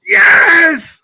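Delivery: high full-throated celebratory yell; screaming yes. Retold or imitated. Excited dad yelling yes in the maternity hall. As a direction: scream it like the game's just won.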